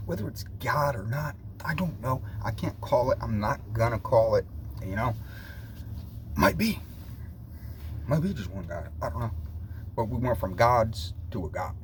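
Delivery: ordinary talk, nothing marked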